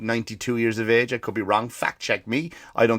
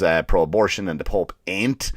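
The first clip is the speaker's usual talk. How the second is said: usual speaking voice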